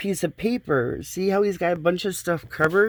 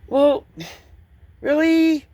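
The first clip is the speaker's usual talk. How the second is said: high, loud mock of him going well, really; squeaky mock of the installer's dismissive shrug; mocking the contractor; 8 semitones higher, 4 dB louder than usual. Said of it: "He just went".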